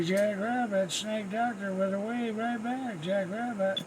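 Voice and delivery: ordinary talk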